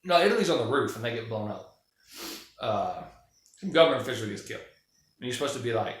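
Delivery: usual speaking voice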